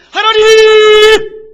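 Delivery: drawn-out shrieking cry of God's name, very high and loud; desperate wailing scream; anguished cry. Acted. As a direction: cry out to God from the depths. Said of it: "절규하는 거예요".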